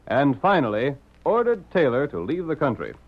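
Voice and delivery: ordinary talk, nothing marked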